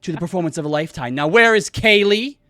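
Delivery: booming, high-pitched showman proclaiming to a crowd; grandiose showman. Acted. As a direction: announce yourself with huge theatrical flourish, stretching the greeting. Flamboyant magician makes grand entrance.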